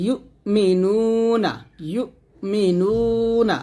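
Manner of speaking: "ইউমিনুনা" slowly chanted, "নু" held long; stretched নু. Shown as a demonstration. Teacher has learners repeat it, "নু" held long. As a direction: draw out the "নু" in "ইউমিনুনা". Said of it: "নু টান দেবেন এক লিফ".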